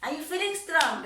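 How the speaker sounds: high, loud upbeat father voice; father's hearty greeting. Retold or imitated. Father checking on his recovering daughter, loud and high.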